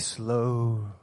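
long, low "Slow" dragged out; drawn out slow. Shown as a demonstration. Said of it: "Everybody say".

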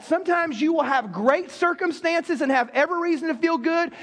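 normal talking voice

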